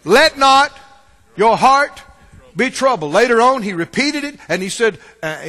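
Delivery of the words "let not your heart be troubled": loud command in separated phrases; emphatic order. Acted. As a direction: lay down the order piece by piece. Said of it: "What's the order?".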